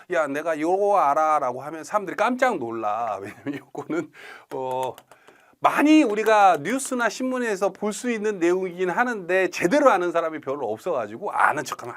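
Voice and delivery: ordinary delivery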